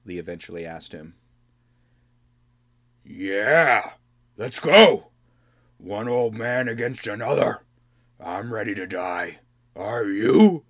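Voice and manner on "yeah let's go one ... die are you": loud, eager goblin king spoiling for a fight; eager challenge. Acted. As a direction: taunt him like an old brawler. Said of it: "Grohl replied eagerly".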